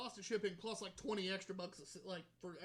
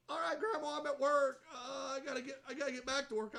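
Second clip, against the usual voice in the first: flustered excuse; 5 semitones higher, 4 dB louder than usual. Retold or imitated. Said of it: "And I was like".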